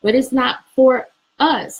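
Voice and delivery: loud, slow, emphatic. Real, not acted. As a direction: drive the point home slowly. Emphatic point.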